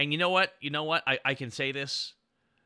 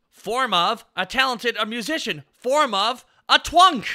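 hammed-up hero call; 6 semitones higher, 7 dB louder than usual. Streamer activating Wonder Twin powers, hammed-up proclamation. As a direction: proclaim it like a cartoon superhero.

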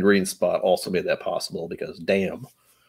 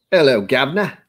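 louder, higher mock Cockney greeting; bad British accent; 5 semitones higher, 6 dB louder than usual. Host greeting in a deliberately bad British accent. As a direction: lay on a terrible British accent. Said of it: "That's bad British".

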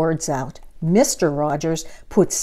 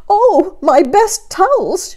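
high, loud, slow wail of dismay; 13 semitones higher, 7 dB louder, slower than usual. Acted. Missus Rogers seeing her towels, high loud wail. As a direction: moan over ruined towels. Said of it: "she said".

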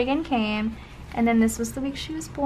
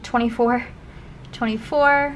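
ordinary talk

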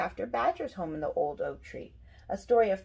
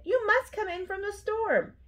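high-pitched urgent call; yelling; 12 semitones higher than usual. A narrator's reading. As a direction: urge a friend indoors, voice raised high. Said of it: "yelled Rabbit".

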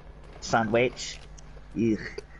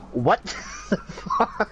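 high, slow, breaking into laughter; laughing disbelief; 8 semitones higher, much slower than usual. Real, not acted. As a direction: crack up halfway through the words. Cracking up over meatless meatball.

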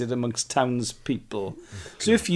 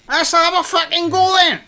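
much higher, loud hammed-up shout; mock fight challenge; 8 dB louder than usual. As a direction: bellow the dare like a drunk picking a fight.

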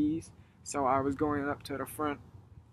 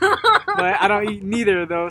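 laughing out the words, louder and slow; 5 semitones higher, 10 dB louder, slower than usual. Real, not acted. Answering the Mexican joke from his host, laughing hard. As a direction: giggle through the confession. Admits not eating peppers, laughing.

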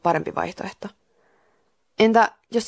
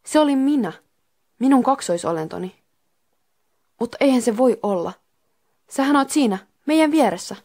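higher-pitched, mildly shocked narrated voice; 6 semitones higher, 3 dB louder than usual. A narrator's reading. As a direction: say it in shocked disbelief, pitch lifted. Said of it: "Tuula sanoi järkyttyneenä".

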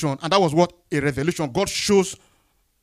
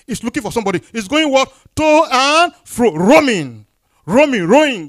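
full-force preaching; 6 semitones higher, 9 dB louder than usual. Acted. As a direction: stretch and ring out the key words.

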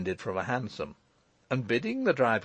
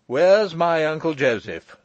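loud, higher-pitched demand; 5 semitones higher, 7 dB louder than usual. A narrator's reading. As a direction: ask it forcefully, like you expect an answer. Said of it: "demanded Michael".

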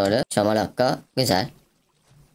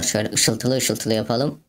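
normal talking voice

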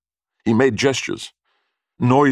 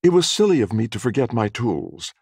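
high female customer explaining; higher-voiced woman pointing out a problem; young woman's voice; 7 semitones higher than usual. A narrator's reading. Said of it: "There was a young woman in the shop".

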